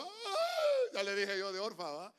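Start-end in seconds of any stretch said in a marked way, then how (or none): 0.0-0.9 high, drawn-out fake wail; Orpah's crocodile-tear wail. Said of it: "Pura lágrima de cocodrilo". (lamento fingido, agudo y alargado)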